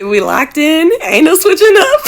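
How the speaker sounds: loud, high and laughing; hyped up. Pretend. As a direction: hype it up with a laugh. Hyped pinky-swear promise.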